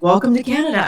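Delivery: lightly voiced cheery officer; imitated beaming cop. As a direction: welcome them through a megawatt smile. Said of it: "megawatt smile".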